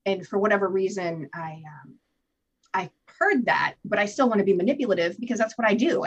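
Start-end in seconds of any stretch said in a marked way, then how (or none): none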